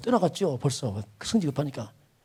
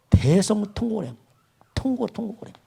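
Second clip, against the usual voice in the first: rising, louder preacher's cry; preaching climax; 5 semitones higher, 3 dB louder than usual. Real, not acted. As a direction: lift it into a full cry of grief. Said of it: "preacher builds on wailing".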